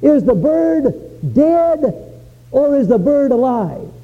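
put-on high, slow, taunting boy's voice; cocky little boy. Little boy taunting the old genius, high slow challenge. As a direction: dare the old man with a sly child's challenge. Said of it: "And he said".

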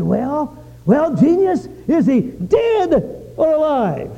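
high, slow teasing challenge; taunting boy. Retold or imitated. Boy daring the genius to answer, high slow taunt. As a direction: drag out the dare with a smirk. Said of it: "looked at the old man and said".

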